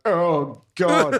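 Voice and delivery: high, loud groan of revulsion; mock disgust. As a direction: groan it out, hammed-up and horrified. Acting out disgust at grandma's back.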